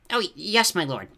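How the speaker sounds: high, stammering, nervous underling; servile toad. A narrator's reading. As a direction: agree hastily and fearfully with your master. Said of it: "the toady agreed".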